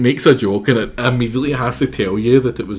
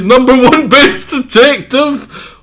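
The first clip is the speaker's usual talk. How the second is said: much higher, louder and slower cartoon voice; hopeful squeaky plea; 10 dB louder, slower than usual. Asking the mouse to forge a title, high and wishful. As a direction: stretch it out like a wishful kid.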